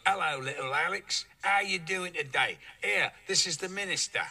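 mock a stuntman botching a posh English accent; the stuntman playing an English doctor without the posh accent; stuntman's flat accent. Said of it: "would have a very upper-class accent".

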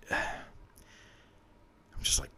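breathy, unvoiced whisper, very slow; worn-out whispered sigh. Real, not acted. Exhausted sigh over regret.

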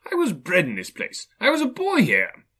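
high, loud, rising excitement; overjoyed old man exclaiming; amazed recognition. Acted. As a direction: cry out in delighted wonder, soaring at the end. Scrooge recognises his childhood place.

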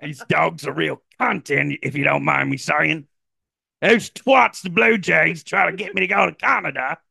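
high, exaggerated Aussie rant; Shohei Ohtani as Australian. Star turning down Canada, hammed-up Australian. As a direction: rant in broad mock Australian. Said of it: "got an Australian accent".

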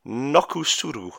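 Nokusuru said slowly in a mock Japanese accent. Shown as a demonstration. Quiz host reading a genuine rugby term, in a slow mock Japanese accent. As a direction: spell out each syllable in a put-on Japanese accent. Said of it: "my best Japanese accent".

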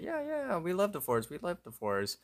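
high, quick eager yeahs; eager agreement. Retold or imitated. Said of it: "all four of them are like".

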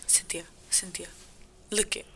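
an urgent whispered call. Retold or imitated. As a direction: hiss her name so nobody else hears. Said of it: "They're like".

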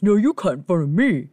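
exaggerated mock voice of an opponent; made-up voice for a rude queue-jumper; put-on man's voice. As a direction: imitate the arguing man, badly on purpose. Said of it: "I don't think his voice sounded like that".

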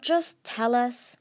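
slow, impatient demand; growled. A narrator's reading. Tsunami demands an answer from Starflight, growling slowly. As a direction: drag it out in exasperation. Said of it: "Tsunami growled".

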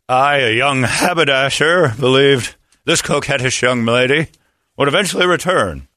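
a hint of an old-time radio narrator building suspense; mock radio broadcaster telling the rest of the story; Paul Harvey. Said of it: "I'm Paul Harvey when it comes".

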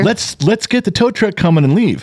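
high, pressing mock yell; urgent order. Father urging his daughter to call a tow truck. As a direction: push her to hurry up. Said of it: "I go".

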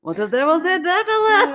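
put-on high, silly French accent. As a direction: ask it in hammed-up French. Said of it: "talk in my French accent".

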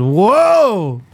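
long, high swooping whoa; stretched-out mock toddler exclamation; Bo's whoa. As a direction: imitate a toddler tipping over. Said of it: "Bo just goes".

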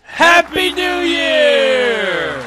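drawn-out joyful shout; cheering. Real, not acted. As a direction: celebrate the new year loudly. Celebration at midnight.

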